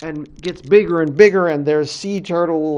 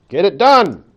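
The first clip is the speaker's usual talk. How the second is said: loud, higher-pitched command; curt order; 5 semitones higher, 8 dB louder than usual. Acted. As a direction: give the order with force. Creator's curt order to Coyote.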